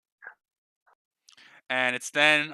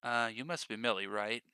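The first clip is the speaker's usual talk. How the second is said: gentle, much softer question; soft greeting; 6 semitones lower, 10 dB quieter than usual. Acted. Lynette softly greeting Millie. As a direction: ease into it softly. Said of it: "It's just like".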